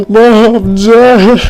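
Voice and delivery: loud, high, sobbing wail; hammed-up crying. Boy finds parents dead, loud exaggerated crying. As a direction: wail it out in broken mock sobs. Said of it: "he started to cry".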